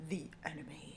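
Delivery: a low hushed "The enemy", almost breathed; ominous hushed voice. Retold or imitated. Friends hushing the dad's nickname in that special voice. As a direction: hush it like naming a villain. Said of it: "we say it in that voice".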